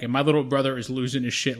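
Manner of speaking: usual speaking voice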